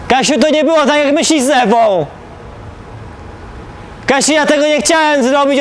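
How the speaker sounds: pleading shouts, stretched at the ends; pleading call. Acted. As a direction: call out to a lover, begging to be heard. Man calls to Kasia, begging forgiveness.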